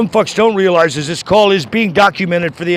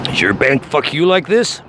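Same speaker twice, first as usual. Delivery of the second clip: normal talking voice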